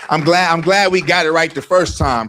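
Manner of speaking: loud hype host welcoming crowd; rally speech. Acted.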